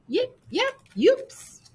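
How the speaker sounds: very high yipping dog; high yips of a fleeing poodle; dog yelps. Acted. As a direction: yelp like a little dog. Dog yelps as cat flees.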